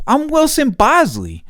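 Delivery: put-on squeaky innocent kid act; goofy put-on voice. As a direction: play dumb in a high cartoonish voice. Goofing on the lunch aide.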